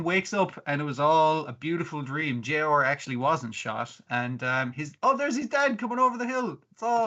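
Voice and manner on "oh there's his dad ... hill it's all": high, excited mock exclamation; excited sighting. Dreamer seeing his dad over the hill, excited. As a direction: act out spotting someone dear, pitched up.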